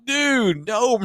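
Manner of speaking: high shouted refusal; retold shout. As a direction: fling out a flat no.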